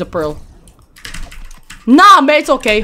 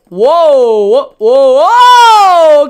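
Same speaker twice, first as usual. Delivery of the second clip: excited whoas; 3 semitones higher, 7 dB louder, much slower than usual. Real, not acted. Gamer yelling whoa mid-fight in excitement. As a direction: shout whoa as the fight explodes.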